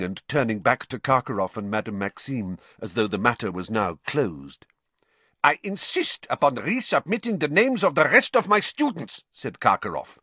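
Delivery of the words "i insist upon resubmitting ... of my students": high-pitched insistence; unctuous tone dropped. A narrator's reading. Karkaroff insisting on new names, no longer unctuous. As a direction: demand it outright in a raised pitch. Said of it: "He had dropped his unctuous tone".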